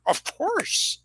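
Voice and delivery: high-pitched talking horse imitation; Mister Ed. Host answering as the talking horse, playful imitation. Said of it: "when Mister Ed said".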